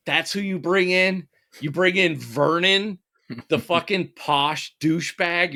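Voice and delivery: high-pitched, drawn-out disbelief; incredulous. Retold or imitated. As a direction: question it as if it's an absurd choice. Acts out his disbelieving reaction to casting.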